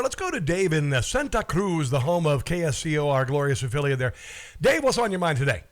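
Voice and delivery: ordinary delivery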